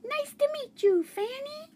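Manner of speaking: high squeaky hamster voice; the hamster greets the goldfish in a squeak. Acted. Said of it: "I squeaked".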